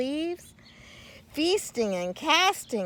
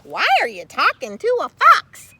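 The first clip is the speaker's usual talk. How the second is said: loud, higher alarmed squirrel; squirrel cries out; 8 semitones higher, 8 dB louder than usual. Acted. Squirrel crying out at Carl, loud. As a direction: cry out in alarm. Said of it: "cried a squirrel".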